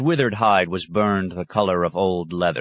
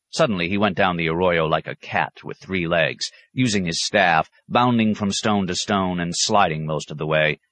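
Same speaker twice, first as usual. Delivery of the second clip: plain reading voice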